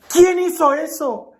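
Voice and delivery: high-pitched, urgent questioning; acted parental alarm, pitch held way up; dismayed father demanding. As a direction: demand answers as an upset father. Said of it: "consternado, le pregunta a la niña".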